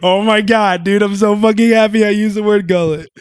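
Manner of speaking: loud, high, thrilled gushing; excited disbelief. Retold or imitated. Retelling delight at saying gullet, loud excited outburst. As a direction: burst out of character, overjoyed. Delighted at using a new word.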